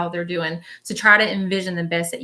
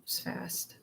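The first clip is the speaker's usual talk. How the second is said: whispered echo; 18 dB quieter than usual. Retold or imitated. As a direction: whisper it, very softly. Repeats spirit's words under breath.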